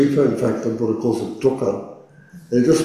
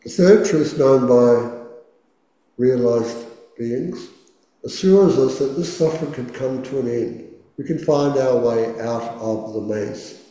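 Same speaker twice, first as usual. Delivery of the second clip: guided meditation. Acted. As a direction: gently guide the listener into stillness. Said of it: "let's do our first meditation".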